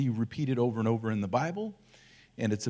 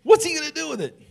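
much higher, laughing what's-he-doing; baffled laugh; 8 dB louder, faster than usual. Pretend. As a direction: squeal in playful disbelief. Blind man baffled by spit.

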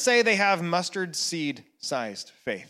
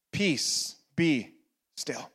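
each word apart, fading to near silence; spaced hush; 6 semitones lower, 7 dB quieter, slower than usual. Acted. Pastor to the sleepless, spaced words fading away. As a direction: let every word settle, softer and softer.

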